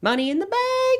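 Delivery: very high squeaky event-name call; playful falsetto. Pretend. Host hamming up tonight's wrestling event, playful falsetto. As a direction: hype it in a silly falsetto.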